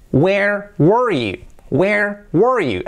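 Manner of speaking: slow, high "Where", then one-syllable "were"; contrasted where/were. Shown as a demonstration. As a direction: separate "Where" as "we-er" from "were" as "wur". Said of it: "Así sería". ("Where" en dos sílabas y "were" en una, despacio)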